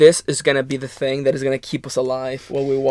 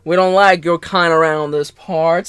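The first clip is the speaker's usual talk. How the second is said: put-on Southern accent. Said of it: "your best Southern accent".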